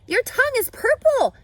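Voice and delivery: Fern's loud, high scream. Acted. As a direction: shriek it across the barn. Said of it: "screamed Fern".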